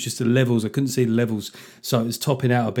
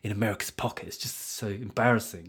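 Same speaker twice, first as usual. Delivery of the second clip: whiny complaint; 3 semitones lower, 8 dB quieter than usual. Retold or imitated. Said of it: "they're like".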